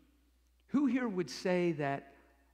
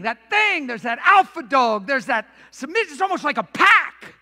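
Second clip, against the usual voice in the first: loud, raised preacher's delivery; full-force preaching; 7 semitones higher, 14 dB louder than usual. Real, not acted. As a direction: drive it home to the congregation, loud and high.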